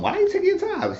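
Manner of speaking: high falsetto, impatient; impatient woman. Retold or imitated. Woman hurrying Lucian, in high impatient falsetto. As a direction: ask it in a high put-on woman's voice.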